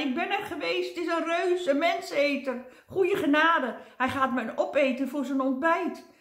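high-pitched, loud wailing in mock terror; hammed-up squeal of doom; panicked shrieking. A narrator's reading. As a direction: shriek as if about to be eaten. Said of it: "gilde hij".

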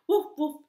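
high, loud playful woof woof; puppy bark. Acted. Mischievous puppy barks at the squirrel, loud playful woofs. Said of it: "stopped to bark".

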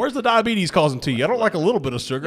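high, loud caricature of a southerner; sweet-tea southerner. Pretend. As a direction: play a fussy tea drinker demanding sugar. Mimicking southerners wanting sweet tea.

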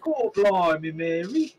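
loud hammed-up Cockney; bad Cockney, high and loud; Cockney accent. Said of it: "really bad Cockney accent".

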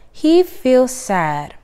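slow, drawn-out sad reading. A narrator's reading. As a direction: stretch it out, feeling sorry for him. Howard in time out.

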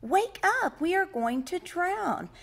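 high, loud storybook alarm; crying out. A narrator's reading. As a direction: cry out to wake the sleeper. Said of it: "they cried".

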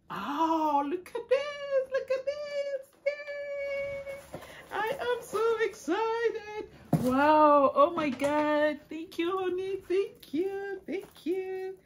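high, squealing delight; excited squeal. Real, not acted. Wife unboxing husband's birthday gift, squealing excitedly.

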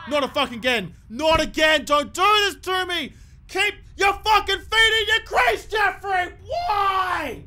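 real fury at a stupid dismissal; furious shout. Real, not acted. Gamer yelling at his batsman Jeffrey for getting stumped, furious shout. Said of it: "I'm so mad".

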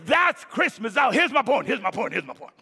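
loud, high, shouted sermon climax; preaching at full force. Acted. Pastor rousing his congregation at Christmas, full-force shouted preaching. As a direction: whip the church up with a ringing shout.

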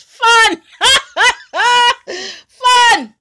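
high, loud, laughing cry of fun; delighted laughing shout; laughing shriek. Real, not acted. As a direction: squeal it out through laughter. Excited shriek about toilet papering.

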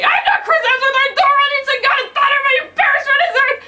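very high, loud screamed protest; exasperated shout. Acted. Thor protesting to a squealing fan, exasperated shout. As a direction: scream the denial at full pitch.

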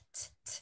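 "t, t," as unvoiced puffs of air, no voice; voiceless t sound. Shown as a demonstration. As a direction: tap the bare t with just breath. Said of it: "want, t". ("t, t," sin voz, solo aire)

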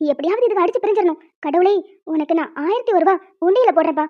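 normal talking voice

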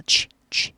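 취 whispered, voice off; unvoiced 취 ending; voiceless chwi. Shown as a demonstration. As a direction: breathe out 취 without voice. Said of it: "목소리를 내지 않아요".

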